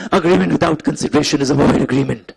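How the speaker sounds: rough, gravelly hoarse voice. Real, not acted. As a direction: voice rasping and worn out. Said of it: "मेरा आवाज खराब है".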